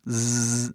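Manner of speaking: "Z" as an even, sustained zzz; buzzing Z sound. Shown as a demonstration. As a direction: keep "Z" buzzing with no vowel. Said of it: "O mesmo som o tempo todo". ("Z" como zzz contínuo, sem vogal)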